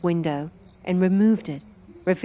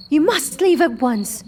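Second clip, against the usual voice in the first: hasty; 9 semitones higher, 5 dB louder than usual. Acted. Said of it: "she explained hastily".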